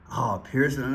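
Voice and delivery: ordinary delivery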